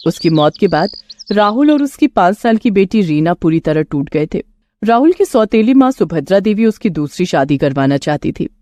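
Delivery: usual speaking voice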